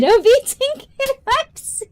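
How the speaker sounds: high laughing words; giggly and delighted. Real, not acted. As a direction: laugh through the line. Laughing at comment.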